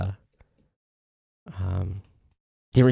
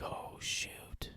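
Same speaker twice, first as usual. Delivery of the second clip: whispered retold reaction; hushed shock; 21 dB quieter than usual. Host replays hearing friend caught the cooties, in a shocked whisper. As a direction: drop to a whisper, as if taking in bad news. Acts out hearing bad news.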